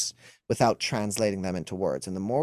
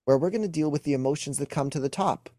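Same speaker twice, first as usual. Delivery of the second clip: normal talking voice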